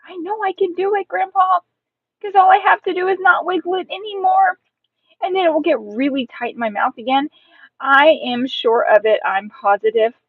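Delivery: put-on high-pitched, emphatic kid; child's confident insistence. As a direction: insist it eagerly to a grown-up.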